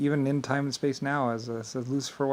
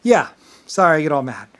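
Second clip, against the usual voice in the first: usual speaking voice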